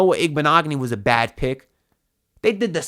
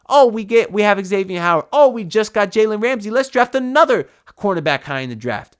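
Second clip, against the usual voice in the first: mocking front office; 6 semitones higher, 5 dB louder than usual.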